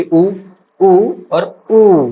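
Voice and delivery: उ short, then long, then very long; three lengths of उ, the last held out; vowel lengthened stepwise. Shown as a demonstration. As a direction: stretch उ further on each repeat. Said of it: "उच्चारण काल के अनुसार".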